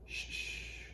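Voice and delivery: soft unvoiced shushing; hushing. A narrator's reading. Little tree hushing his friends, whispered. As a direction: hush the others urgently. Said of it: "said the little tree".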